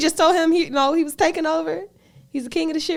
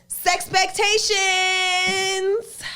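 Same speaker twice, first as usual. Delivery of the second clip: long, slow announcer drawl on the title; segment announcer; 7 semitones higher, much slower than usual. Acted. Host announcing the next segment to party people, drawn-out showman call. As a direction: stretch it out like a show host.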